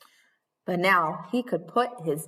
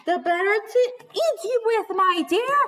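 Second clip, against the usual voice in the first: higher, louder hammed-up wolf; wolf's menace; 11 semitones higher, 4 dB louder than usual. Wolf about to eat Little Red, higher and louder. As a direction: build to the pounce.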